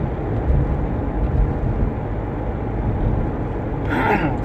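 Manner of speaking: long rough throat clear. Real, not acted. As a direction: clear the phlegm from your throat.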